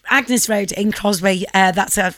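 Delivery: ordinary talk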